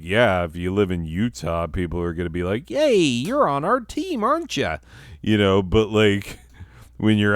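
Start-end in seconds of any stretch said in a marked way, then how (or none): 2.7-4.8 high-pitched mock-gleeful cheer. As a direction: squeal it like an overeager welcoming team. Mimicking welcoming people in Utah.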